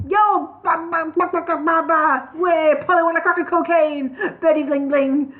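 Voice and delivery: high, loud squawking rap; agitated bird rant. Acted. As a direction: squawk it out in a frenzy. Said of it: "fiercely agitated".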